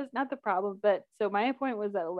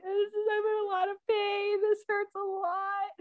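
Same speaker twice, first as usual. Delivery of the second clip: acted-out tearful complaint; retold crying; 10 semitones higher, 4 dB quieter than usual. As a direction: whimper it high and stretched.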